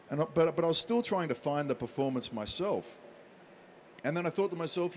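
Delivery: ordinary delivery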